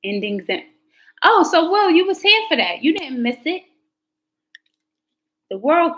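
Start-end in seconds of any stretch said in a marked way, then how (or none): 1.2-3.6 higher and louder, suddenly lit up; excited call-out. Real, not acted. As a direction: call out to Will, thrilled he was there. Calling out to Will in chat.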